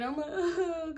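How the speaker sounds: high mock-tearful wail; imitated crying. Storyteller showing her emotional drunk self, high mock crying. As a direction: break briefly into a pretend sob. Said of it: "I'm, like, crying".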